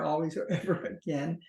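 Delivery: ordinary delivery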